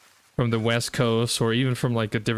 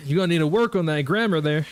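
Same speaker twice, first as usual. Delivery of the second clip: quick, pushy mock Southern accent, a bit higher; 4 semitones higher, faster than usual. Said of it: "some Southerners that if you speak proper".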